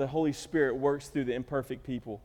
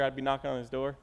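usual speaking voice

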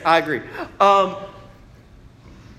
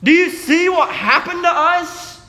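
pleading cry; 10 semitones higher, 5 dB louder than usual. Acted. Martyrs crying out to God.

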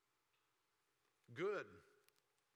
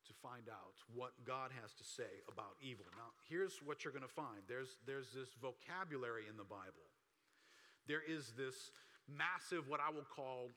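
ordinary talk

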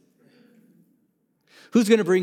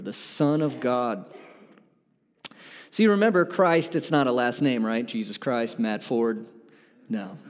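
ordinary talk

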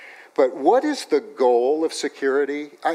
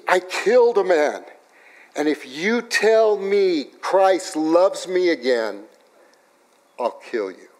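mock threat; 6 semitones higher, 4 dB louder, slower than usual. Menacing man's threat retold.

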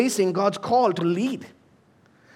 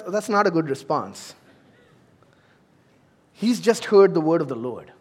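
ordinary talk

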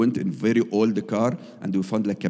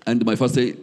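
ordinary delivery

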